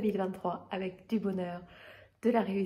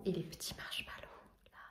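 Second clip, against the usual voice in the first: whispering; 3 semitones lower, 12 dB quieter than usual. Real, not acted. Showing the drink quietly.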